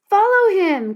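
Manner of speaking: high, loud urgent call; boy calling to his sister to chase the cat, loud; cried out. A narrator's reading. Shout it out urgently. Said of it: "cried Jack".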